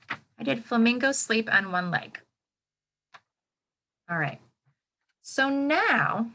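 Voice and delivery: ordinary delivery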